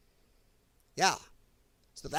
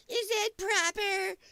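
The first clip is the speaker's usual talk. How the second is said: mocking prissy Democrat; 13 semitones higher, slower than usual. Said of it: "Democrats piss and moan".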